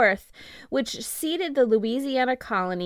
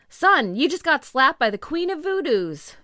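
lightly acted, raised-pitch quote; quoted exclamation; 6 semitones higher, 5 dB louder than usual. Podcaster reads the clerk's words to a slapped man, lightly acted exclamation. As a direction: read the quote out louder and higher. Quoting clerk, before accent attempt.

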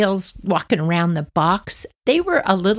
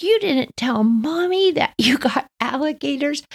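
put-on much higher childish disbelief; little sister shocked; 10 semitones higher than usual. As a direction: gasp it like a small girl. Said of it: "I was like".

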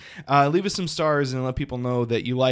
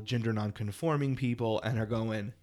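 normal talking voice

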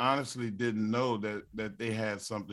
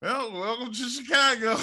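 gleeful quip; 10 semitones higher, 7 dB louder than usual. Retold or imitated. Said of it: "And I'm like".

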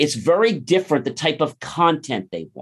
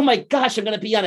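imitating thrilled clerk; 8 semitones higher, faster than usual. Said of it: "She's like".

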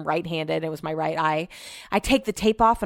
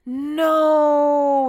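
long drawn-out, higher-pitched wail; retold dismay; 7 semitones higher, 4 dB louder than usual. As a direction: imitate an exasperated partner stretching the word out. Retells partner's dismay at potatoes.

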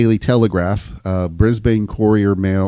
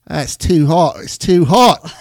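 high-pitched hammed-up Aussie voice; mock Australian accent; 6 semitones higher, 5 dB louder, slower than usual. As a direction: play the victim as a cartoon Australian. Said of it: "That's Australian".

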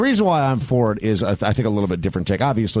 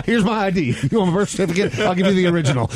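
laughing; 9 semitones higher than usual. Acted. Laughing mock protest.

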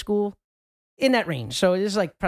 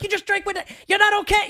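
mock exasperated mother, much higher and louder at the end; stern mom; 5 dB louder than usual. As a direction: shut him down like an angry parent. Mom overrules drunk friend.

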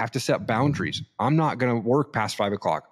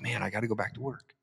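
a much softer, deflated sigh of a line; resigned dad voice, much softer; weary regret; 11 dB quieter than usual. Retold or imitated. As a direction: let it drop away, sorry to leave. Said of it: "And I'm like".

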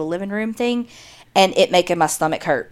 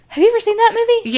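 much higher, rapid excited question; eager quick question; 14 semitones higher, much faster than usual. Real, not acted. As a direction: fire it off eagerly.

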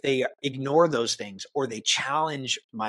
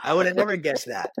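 high, quick mock surprise; mock amazement; 6 semitones higher, 4 dB louder, faster than usual. Speaker ribbing leaders who name leadership, mock amazement. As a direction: pretend to be astonished by the obvious.

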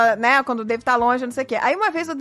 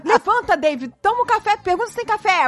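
higher, pushing urging; urgent mock plea; 6 semitones higher than usual. Wife retelling urging husband to wake, higher. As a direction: urge him awake from afar. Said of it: "Eu falei".

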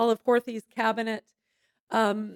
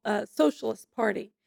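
ordinary talk

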